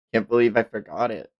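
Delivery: ordinary talk, nothing marked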